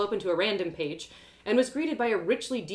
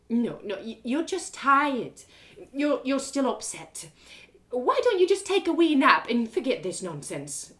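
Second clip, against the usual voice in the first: higher, slow coaxing mother; pleading mum; 6 semitones higher, 5 dB louder than usual. Acted. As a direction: talk her out of it anxiously. Mum pleads with her daughter.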